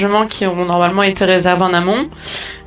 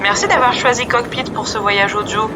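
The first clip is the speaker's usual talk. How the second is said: captain's announcement over the intercom, softer; calm airline pilot PA voice; pilot cockpit headset; 3 dB quieter than usual. Acted. Pilot announcement closing the podcast.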